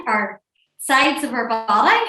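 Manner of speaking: ordinary delivery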